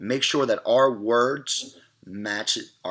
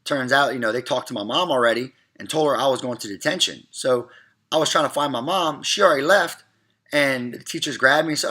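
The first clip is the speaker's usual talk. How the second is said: usual speaking voice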